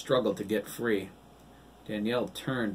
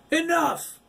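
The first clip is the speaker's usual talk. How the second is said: very high, loud command; bellow; 9 dB louder than usual. A narrator's reading. Man bellows to silence the church, very loud. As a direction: silence the room.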